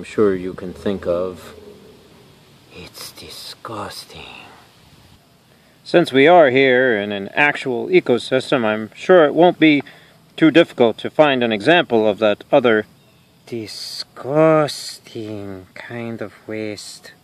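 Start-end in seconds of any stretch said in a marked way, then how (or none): 2.7-4.5 slow, drawn-out whisper; disgusted whisper. Acted. Host on other kinds of waste, slow disgusted whisper. 13.5-17.1 slow, very soft, stretched-out whisper; disgusted whisper. Acted. Host hinting at animal poop, slow disgusted whisper. Host hushes the gross words.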